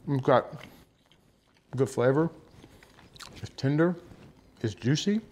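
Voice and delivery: talking through a mouthful; chewing while talking; mouth full. Real, not acted. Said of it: "I'm talking with my mouth full".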